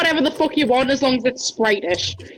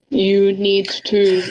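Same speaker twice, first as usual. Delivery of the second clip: put-on Russian accent; 6 semitones lower, much slower than usual. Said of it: "I don't know how to fucking do a Russian accent".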